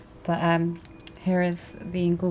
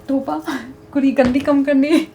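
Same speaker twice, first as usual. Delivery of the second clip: put-on punjabi accent; 8 semitones higher, 6 dB louder than usual. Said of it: "She'll say".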